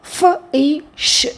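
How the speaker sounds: "ఫ, ఈ, ష్" as three separate sounds; sounded out. Shown as a demonstration. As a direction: spell "ఫ, ఈ, ష్" by sound, f, ee, sh. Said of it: "వీక్ సౌండ్స్కి ఒక vowel sound".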